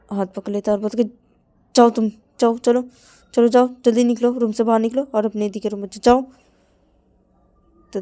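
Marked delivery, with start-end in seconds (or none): 1.7-6.2 loud, high urgent command; raised hurried order; pushing him out. Acted. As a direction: shove him out with words. Pulling his hand, ordering out.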